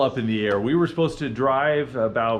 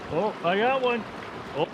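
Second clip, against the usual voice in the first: normal talking voice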